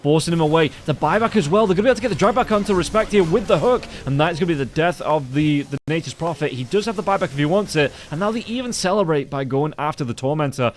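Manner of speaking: fast, high, loud fight call; excited play-by-play. Acted. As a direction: call the teamfight at full speed. Live teamfight play-by-play.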